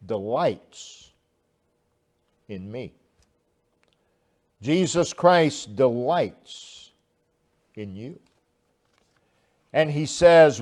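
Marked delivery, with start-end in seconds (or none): none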